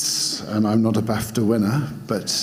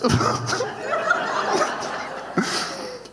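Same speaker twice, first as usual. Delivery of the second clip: high burst of laughter. Real, not acted. Cracks up at own voice.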